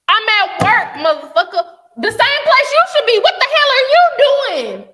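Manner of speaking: loud, high, exasperated snap back; angry retort. Acted. Answering a man who texts during her work hours, loud angry retort. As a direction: tell him off loudly, like he should know better.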